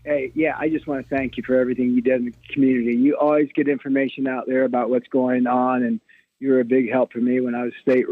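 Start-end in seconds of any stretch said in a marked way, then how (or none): none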